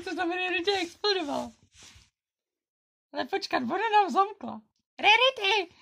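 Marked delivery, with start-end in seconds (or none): 5.0-5.7 louder call for a friend. Pretend. Locked out pony calling Rarity by name, loud. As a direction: call her name toward the house.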